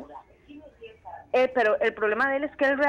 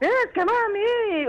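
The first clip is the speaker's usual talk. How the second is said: put-on high, slow whining child's plea; whiny crying kid; 9 semitones higher, much slower than usual. Mother imitating her son's whiny plea. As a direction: whine it like a kid about to cry. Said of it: "es puramente oír a la chilindrina llorando".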